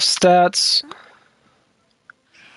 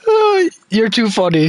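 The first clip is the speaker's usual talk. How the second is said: ordinary talk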